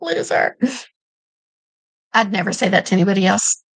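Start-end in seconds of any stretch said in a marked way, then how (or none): 0.0-0.6 teasing sibling jab, high; playful high taunt; mock insult. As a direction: tease like you would your sister. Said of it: "I have to say that to my sister".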